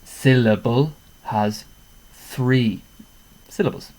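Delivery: "three" stressed loud and slow; teacher emphasis. Shown as a demonstration. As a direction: spell it out for the class, slow and firm. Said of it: "Syllable. One, two, three".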